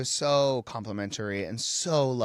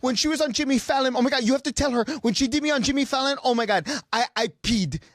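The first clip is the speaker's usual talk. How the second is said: high, loud, breathless-paced imitation of a gushing star; excited gushing; 4 dB louder than usual. Star meets fan's idol's brother, gushing excitedly. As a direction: act out her delighted shock, pitched way up. Acting out star's gushing reaction.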